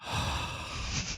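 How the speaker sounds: long soft sigh of awe; deep sigh. Shown as a demonstration. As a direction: let out a slow, contented sigh. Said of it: "and that sigh".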